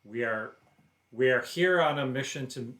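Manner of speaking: loud, emphatic announcement; bold declaration. Acted. Player declares the party's mission to a stranger, bold declaration. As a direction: step in and declare it firmly. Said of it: "I will step in and declare".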